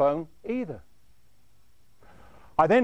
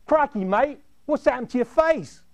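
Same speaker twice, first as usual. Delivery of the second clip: put-on lightly done Australian accent, pitched higher; a friendly Aussie bloke in an Australian accent, a bit raised; 7 semitones higher, 4 dB louder than usual. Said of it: "they had Australian accents".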